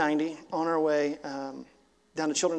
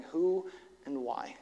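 hushed desperate question; 6 dB quieter than usual. Retold or imitated. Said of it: "staring at the gallows and going".

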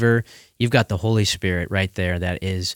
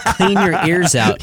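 exasperated parent; 8 semitones higher, 3 dB louder, slower than usual. Retold or imitated. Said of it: "I'm like".

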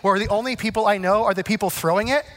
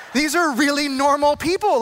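relieved surprise; 5 semitones higher than usual. Retold or imitated. Relief at the party.

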